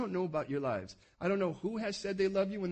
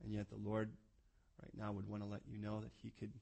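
soft closing prayer; quiet closing prayer; 9 semitones lower, 6 dB quieter than usual. Acted. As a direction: pray gently over the room. Leads closing prayer softly.

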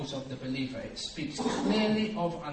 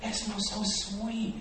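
savouring freedom; 6 semitones higher, slower than usual. Acted. Freed man enjoying the sunshine, slow dreamy sigh. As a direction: breathe in the free air slowly. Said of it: "You're like".

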